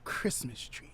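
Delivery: dramatic whisper. Acted. Revealing her guess after the pause, dramatic whisper.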